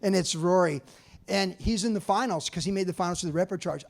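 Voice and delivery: usual speaking voice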